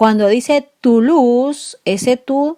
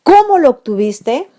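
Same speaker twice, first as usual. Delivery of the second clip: higher, louder amazed exclamation; exclaimed; 6 semitones higher, 6 dB louder than usual. Shown as a demonstration. Teacher modelling an exclamative cómo, amazed. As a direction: marvel at it aloud. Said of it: "En exclamativo".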